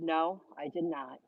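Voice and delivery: quiet, serious denial. Retold or imitated. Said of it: "looked dead at me, eye to eye".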